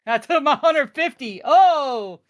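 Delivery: loud, high cheer at a winning trade; excited. Real, not acted. Trader cheering at chat as his target hits, loud and excited. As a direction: celebrate the hit out loud. Hitting profit target live.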